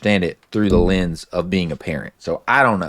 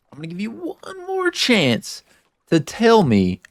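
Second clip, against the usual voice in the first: voicing Ellie's ultimatum; 11 semitones higher than usual. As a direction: lift into Ellie's voice for her last warning. Retelling Ellie's ultimatum to Joel.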